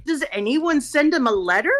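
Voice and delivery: incredulous. Retold or imitated.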